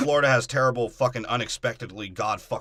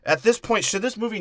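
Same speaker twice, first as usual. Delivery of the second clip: indignant outburst; 8 semitones higher, 5 dB louder than usual. Real, not acted. Indignant complaint.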